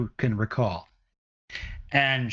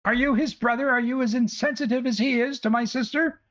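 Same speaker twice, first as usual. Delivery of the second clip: much higher, ranting village mother; angry mother; 12 semitones higher than usual. Acted. Mother rages at the party over her lost son, much higher. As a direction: rant at the adventurers, shrill with worry.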